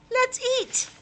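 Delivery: high, loud eager exclaim; hungry child bursting out happily; excited hunger. Acted. Albert announces hunger to family, high and loud.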